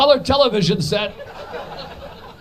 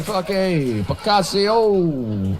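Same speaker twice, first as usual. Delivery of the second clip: Picasso drawn out very slowly in a hammed-up Italian voice; slow mock Italian; 2 semitones lower, much slower than usual. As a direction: savour Picasso like a self-important Italian artist. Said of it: "I thought he was like".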